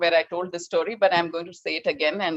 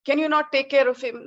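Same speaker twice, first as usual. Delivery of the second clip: angry scolding; 7 semitones higher than usual. Retold or imitated. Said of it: "he got so angry with his housekeepers".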